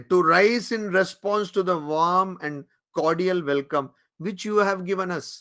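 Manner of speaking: extremely slow, each word drawn out; slow solemn quote. Retold or imitated. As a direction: savour the words as a sacred quotation. Quoting Vivekananda's speech. (অত্যন্ত ধীরে, গম্ভীর উদ্ধৃতি)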